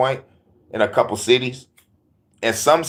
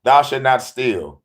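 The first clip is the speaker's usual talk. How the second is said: 1 slow solemn quote; slower than usual. Acted. Quoting commandments solemnly.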